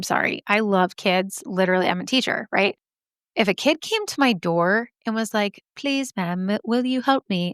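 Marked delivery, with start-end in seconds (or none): 5.8-7.5 mock British accent, pleading kid; British child. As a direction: imitate a polite little British child asking for help. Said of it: "I don't know why they're British".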